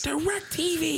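hammed-up screech to close the pitch; John Taffer yelling. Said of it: "You sound like, uh, the parrot from".

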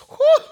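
sharp high loud whew with a laugh; exasperated whew. Retold or imitated. Annoyed driver reacting to chewing, loud high whew. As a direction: blow it out, fed up.

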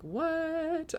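high, drawn-out mock "what?"; incredulous. Retells her baffled reaction to coach.

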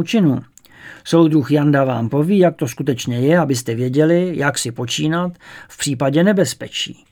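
loud, formal headmaster's address to the school; stern headmaster addressing pupils. Acted. Headmaster calls the pupils to order, loud and formal. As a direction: announce it to the whole gym with authority.

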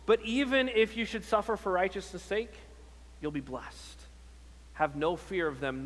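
ordinary delivery

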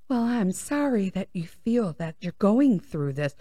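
quiet, syrupy mock apology; customer service voice. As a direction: imitate the agent's soft scripted sympathy. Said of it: "she's in customer service voice".

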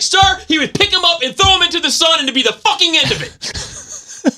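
very high, impassioned mock outburst; retold heated rebuttal. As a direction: fire back at the instructor, voice way up.

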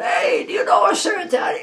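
high, loud call across the water; yelled across the dock. Retold or imitated. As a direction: shout to someone far off. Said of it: "Some guy yelled out".

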